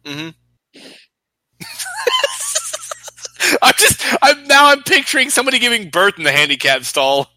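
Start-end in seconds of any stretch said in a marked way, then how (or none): 0.8-7.3 amused squeal of a delivery; cracking up. Real, not acted. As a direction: barely get it out for laughing. Cracking up at mental picture.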